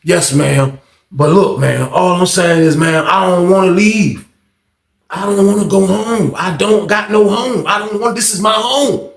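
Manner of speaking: lightly voiced, a hint of a scolded child pleading; meek kid. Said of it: "turned back into a fucking state struck ass kid".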